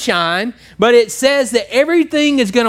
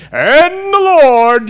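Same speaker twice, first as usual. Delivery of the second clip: slow, loud, higher holy-roller prayer voice; prayer voice impression; 5 semitones higher, 5 dB louder, much slower than usual. Preacher mocking a man who changes his voice to pray, slow and booming. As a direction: mimic a pompous church prayer. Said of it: "his whole tone of voice changes".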